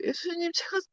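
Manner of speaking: high, pleading confession to Jesus; high, abashed plea in prayer; sheepish prayer. Retold or imitated. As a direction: beg Jesus, too ashamed to apologize. Embarrassed prayer asking Jesus.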